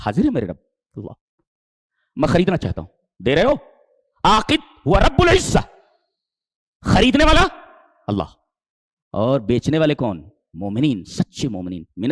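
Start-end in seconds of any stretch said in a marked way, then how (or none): 4.2-7.5 high, loud, slow ringing proclamation; preaching at full force. Acted. Preacher naming God as the buyer, high and loud. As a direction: proclaim it slowly to the hall.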